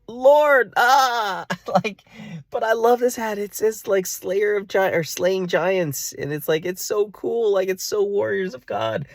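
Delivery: very high, loud pleading whine; hammed-up tearful begging to keep it; whiny mock protest. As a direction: wail it like a kid losing a toy. Mock protest to God over hat.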